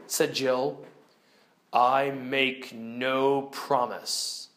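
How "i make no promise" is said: lower, very slow, grave lion; solemn lion. Acted. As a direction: weigh each word heavily. Lion's stern reply.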